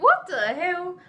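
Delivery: high, loud outraged exclamation; retold outburst, voice shooting up; indignant disbelief. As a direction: act out being appalled in an argument. Retold outraged reply in quarrel.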